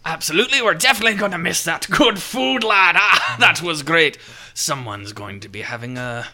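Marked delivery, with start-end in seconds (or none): 0.1-4.2 loud, high jolly sea captain; a hearty captain bidding farewell; pirate captain voice. Acted. Captain answers the noble.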